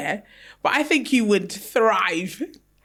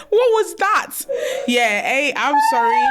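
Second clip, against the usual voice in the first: laughing; 12 semitones higher than usual. Real, not acted. Laughing at friend's accent.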